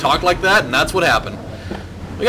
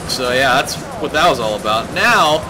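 normal talking voice

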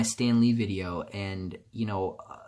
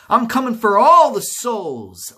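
higher, louder hammed-up grim reaper; mock Death voice; 11 semitones higher, 10 dB louder, slower than usual. Voicing Death coming for everyone's souls, in a higher, louder mock-ominous voice. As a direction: ham it up as Death come to collect, loud and high. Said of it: "death is like".

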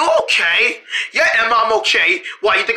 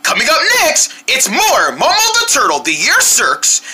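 TV announcer; 5 semitones higher than usual. Acted. TV announcer bumper.

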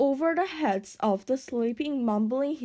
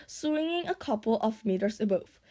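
plain reading voice

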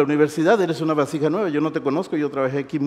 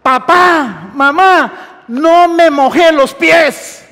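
high, loud shout to the parents; drawn-out, full-voice announcement; triumphant call; 11 semitones higher, 10 dB louder, much slower than usual. Acted. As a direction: call it out long and loud, word by word. Le digas.

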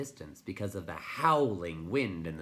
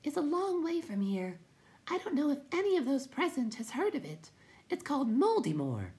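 very high, tiny voice; timid creature; 3 dB louder than usual. A narrator's reading. Will-o'-the-Wisp describes its home, high timid voice. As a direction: tell it in a small high pipe.

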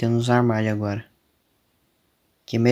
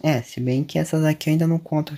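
ordinary talk

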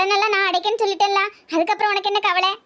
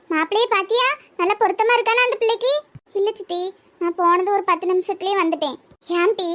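normal talking voice

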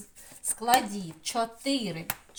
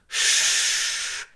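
long loud hissing SH; angry cat hiss; 7 dB louder than usual. Shown as a demonstration. Teacher imitating cross kitties, long loud hiss. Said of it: "кіхтика зашипіли".

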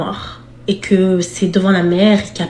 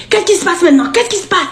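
puzzled cop; 9 semitones higher, faster than usual. Retold or imitated. Police asking what happens.

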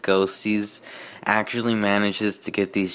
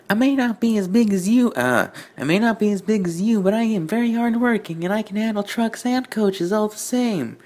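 much higher put-on voice, insistent; Twilight the engine, proud; 12 semitones higher than usual. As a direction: play the small engine standing her ground, pitched way up.

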